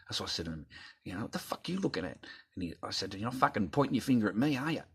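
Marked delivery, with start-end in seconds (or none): 1.2-2.2 higher-pitched mock confrontation; retold challenge. Telling how he challenged the doctor, confrontational. 3.1-4.8 quick, higher confrontational retort; retold indignant challenge. Telling how he answered the pointing doctor, indignant.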